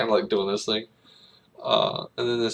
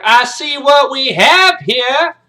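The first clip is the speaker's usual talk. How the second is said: drill-instructor tirade, high and very loud; slow, hammered shouting at new cadets; Major Payne; 15 dB louder, slower than usual. Acted. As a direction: bellow every word slowly to assert authority. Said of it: "He's like".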